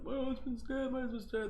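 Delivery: high, soft imitation of a crying wife; mock crying. Retelling the wife on Cops, mock crying over her husband. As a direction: wail it quietly and high, hammed-up. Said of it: "the wife is, like, crying".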